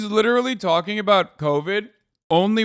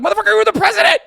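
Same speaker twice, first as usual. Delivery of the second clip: raised, higher pitched yell of disbelief; exasperated yell; 10 semitones higher, 5 dB louder, much faster than usual. Real, not acted. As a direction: yell it at him, fed up. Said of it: "It's like".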